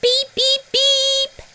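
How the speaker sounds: very high beeping horn; cheerful high beep beep beep; truck beep. A narrator's reading. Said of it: "said Little Blue".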